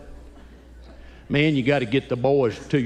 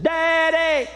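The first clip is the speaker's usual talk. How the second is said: put-on proud little boy announcement; slower than usual. Son announces in public.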